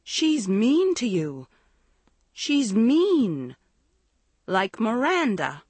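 put-on aggrieved, childlike whine; sounding hurt. A narrator's reading. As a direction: stretch MEAN with a hurt swoop. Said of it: "sounding hurt".

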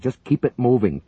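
high, loud order. A narrator's reading. Paramedic ordering the agent, loud. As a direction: press him to keep firing.

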